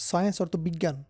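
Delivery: normal talking voice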